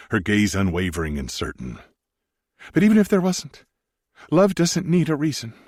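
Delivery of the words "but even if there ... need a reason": higher, louder woman's voice, tender; Sarah's voice. Acted. Wife reassures Maximus he needn't explain, in a higher woman's voice. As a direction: speak as the gentle wife, pitched up.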